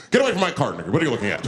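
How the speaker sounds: loud alarmed shopper; mock yell. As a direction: shoo him away from the cart.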